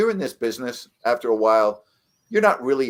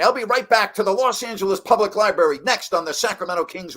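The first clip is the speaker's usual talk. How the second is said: high, loud booming announcer voice; broadcast sign-off; 10 semitones higher, 3 dB louder than usual. Retold or imitated. Announcer sending the game to commercial, booming and high. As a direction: sell it like a radio play-by-play man going to break.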